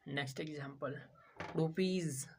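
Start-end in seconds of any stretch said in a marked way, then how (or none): 1.5-2.3 रुपीज with a buzzed z ending; voiced S demo. Shown as a demonstration. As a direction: say रुपीज, final S as z. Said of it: "जौ का".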